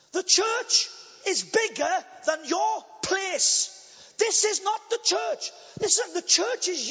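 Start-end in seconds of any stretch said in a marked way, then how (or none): none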